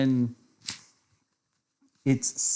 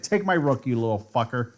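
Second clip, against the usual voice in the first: normal talking voice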